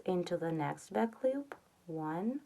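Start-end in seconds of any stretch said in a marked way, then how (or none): 1.9-2.4 quiet murmured count under the breath; soft counting. Real, not acted. Counting stitches for crochet viewers, softly murmured.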